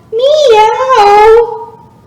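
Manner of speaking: high, loud playful meow; cat meow. Shown as a demonstration. Yoga teacher showing kids the cat pose sound, high playful meow. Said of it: "I wanna hear your cat sound".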